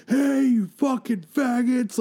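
high, slow drive-by holler; drawn-out mock heckle; imitated heckler's yell. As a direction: holler an insult from a passing car. Retold heckle from passing car.

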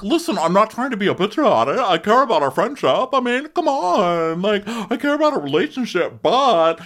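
very high, loud pleading voice; hammed-up earnest protest; mock defensive girl. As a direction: Julia defending herself to Gabby, very high. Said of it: "she's like".

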